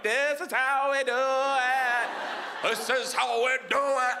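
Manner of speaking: slow, high, stretched-out chorus; mocking crew echo. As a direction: drag every word out like a goofy backup crew. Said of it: "my crew's behind him like".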